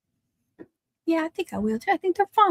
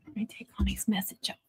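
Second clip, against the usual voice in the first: whispering; 11 dB quieter, faster than usual. Real, not acted. Muttering to self.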